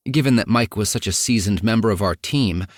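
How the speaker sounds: normal talking voice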